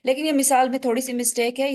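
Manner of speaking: ordinary talk, nothing marked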